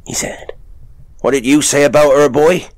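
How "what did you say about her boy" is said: higher, louder, fast rough father voice; gruff father. Acted. Father quizzing his son for the visitor, rough voice. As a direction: bark a question at the boy.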